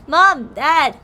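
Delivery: calling out. Acted. Childhood friend greeting the parents, calling out. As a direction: call out to the parents as they arrive.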